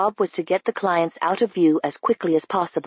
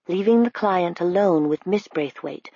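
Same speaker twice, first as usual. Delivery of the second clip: ordinary delivery, nothing marked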